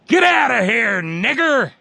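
high, loud mock bigot's shout; retold hostile yell. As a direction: shout it as an imitation of an aggressor. Retold racist yelled at boy.

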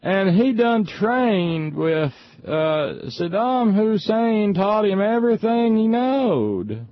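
high, slow, drawn-out yokel caricature; mock a ranting wing nut in a high put-on voice, stretching every word; hammed-up ignorant partisan hollering; screaming wing nut. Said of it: "wing nuts would scream".

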